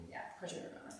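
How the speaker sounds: breathy teasing whisper to the co-host; whispered aside. Real, not acted. Whispering to her friend that of course he remembers. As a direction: whisper it playfully, almost under the breath.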